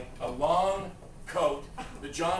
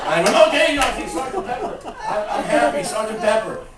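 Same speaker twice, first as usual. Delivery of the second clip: high, loud, frantic interruption; frantic agreeing; 10 semitones higher, 6 dB louder than usual. Retold or imitated. Songwriter interrupting the rambling singer, frantic placating. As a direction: cut in high to stop the loop. Cutting off [name]'s endless repeating.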